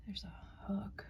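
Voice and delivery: soft whispered aside. Real, not acted. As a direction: whisper it as if discovering something. Quietly showing the old fireplace.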